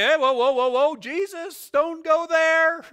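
high, loud protest, stretched at the end; hammed-up panicked attempt to stop someone; mock alarm. Believers trying to rein in Jesus, high mock alarm.

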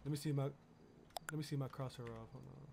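normal talking voice

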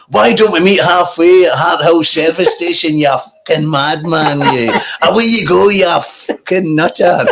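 high, ranting comedian impression; gleefully abusive rant in an impersonated comic's voice; hammed-up exasperated comic tirade; Billy Connolly. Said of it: "I'm Billy Connolly".

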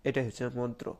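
usual speaking voice

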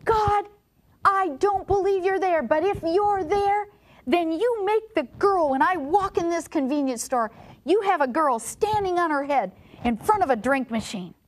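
high-pitched, acted cry to God; retold angry shout. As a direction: imitate a desperate man challenging God. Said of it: "he was so angry at God that he just looked up and he shouted".

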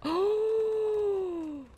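high, drawn-out astonished "oh"; long surprised gasp. Real, not acted. Player sees the killer's move, long surprised gasp. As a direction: gasp in amazement and hold it. Startled by a game event.

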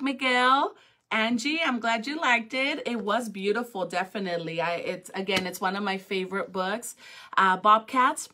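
ordinary delivery

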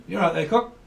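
chirpy northern English greeting; playful up-north accent, lilting. Acted. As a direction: imitate matey Northerners swapping hellos. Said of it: "people up north call people cock".

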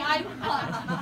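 ordinary talk, nothing marked

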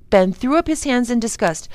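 usual speaking voice

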